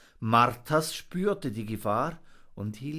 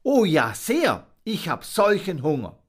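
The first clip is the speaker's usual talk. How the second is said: higher, louder, eager answer; eager monkey; 6 semitones higher, 7 dB louder than usual. Acted. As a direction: gush out your hunger.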